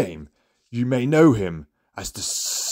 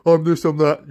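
higher, sneering parody of his boasting; mock-boastful quote; mocking his bragging; 6 semitones higher, 4 dB louder than usual. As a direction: mimic him showing off. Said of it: "I'm fed up with your".

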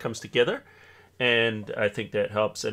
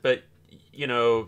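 ordinary delivery

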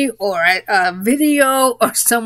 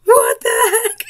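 high, frantic screams of panic; imagined panic while playing a horror game with friends, screaming; mock panicked screaming; 11 semitones higher, slower than usual. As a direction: shriek like you're fleeing a monster. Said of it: "I will be screaming my head off".

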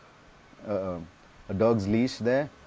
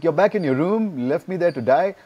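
high, loud acted outrage; retold reproach, pitched up and louder; indignant accusation; 7 semitones higher, 6 dB louder than usual. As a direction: confront him in raised-voice disbelief. Acted confrontation with absent teacher.

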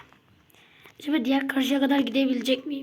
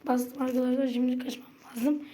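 ordinary talk, nothing marked

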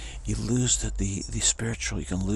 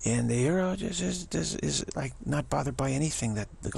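ordinary talk

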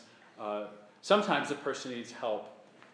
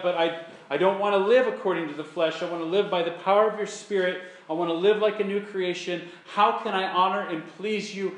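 louder, higher fervent prayer; earnest prayer; 5 semitones higher, 7 dB louder than usual. Shown as a demonstration. Believer crying out to God in a conflict, fervent. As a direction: pray it out with fervour.

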